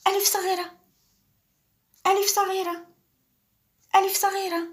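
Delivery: high playful voice for the small letter; tiny high voice. Shown as a demonstration. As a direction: make it sound little, like the small alif. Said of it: "teacher drills the small alif".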